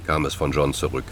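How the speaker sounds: usual speaking voice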